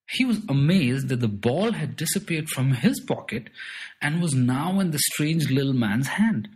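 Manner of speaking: ordinary delivery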